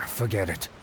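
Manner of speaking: low, soft, defeated drop of the voice; a quiet, weary sigh of surrender; resigned giving up. Acted. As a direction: let the argument go, beaten.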